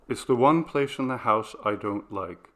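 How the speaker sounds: ordinary delivery, nothing marked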